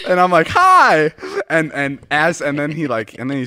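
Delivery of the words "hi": high, loud squeaked hi; a flustered fan blurting hello; starstruck hi. Retold or imitated. Greeting DiCaprio, a starstruck high squeak.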